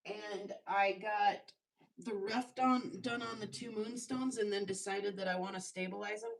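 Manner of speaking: ordinary delivery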